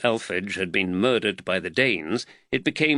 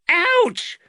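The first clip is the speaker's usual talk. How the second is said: high, loud yelp; sudden high-pitched boy's cry; squeal of pain; 6 dB louder than usual. A narrator's reading. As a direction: squeal like a cuffed boy. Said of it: "Nathaniel squealed".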